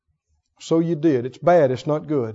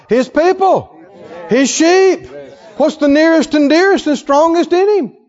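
higher and louder, driving each phrase home; preaching at full force; 9 semitones higher, 6 dB louder than usual. Acted. Preacher stirring his congregation, full force. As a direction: preach it to the back of the church.

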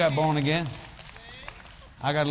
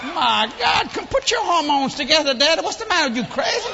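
loud, high comic scolding; retold scandalised outburst; 9 semitones higher, 8 dB louder than usual. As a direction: scold Dad at full voice in mock horror. Retold outrage at father's long kiss.

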